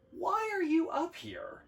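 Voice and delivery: pitched well up, curious; puzzled question. A narrator's reading. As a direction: ask it high and wondering. Asking Large about his presence.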